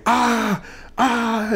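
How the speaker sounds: high, strained cry of pain; a hammed-up wounded yelp; mock pain scream. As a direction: imitate the kid yelling over his leg. Injured juvenile screaming in pain.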